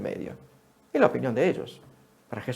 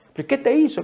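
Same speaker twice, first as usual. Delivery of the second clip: sharp, high, louder challenge; demanding question; 11 semitones higher, 5 dB louder than usual. Retold or imitated. Neighbours demanding of the healed man, sharp and high. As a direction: press him for an answer, suspicious.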